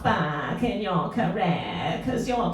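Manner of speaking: very slow, drawn-out posh careers advisor; posh drawl. Retold or imitated. Mocking the careers advisor, slow posh drawl. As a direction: stretch every word like a creaking old door. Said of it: "that kind of drawl".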